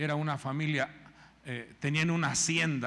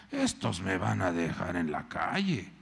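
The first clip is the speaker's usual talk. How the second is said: grumbling Elimelec; 11 semitones lower than usual. Retold or imitated. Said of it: "Elimelec se enojó".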